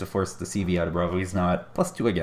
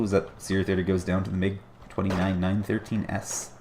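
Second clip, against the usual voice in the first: usual speaking voice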